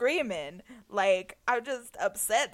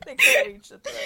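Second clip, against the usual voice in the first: They can't reach the throat said higher and laughing; laughing repeat; 5 dB louder than usual. Real, not acted.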